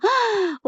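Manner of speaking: sharp, high intake of breath; shocked gasp. Acted. Muthu hears the twenty thousand bill, shocked gasp.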